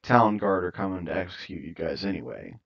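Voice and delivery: usual speaking voice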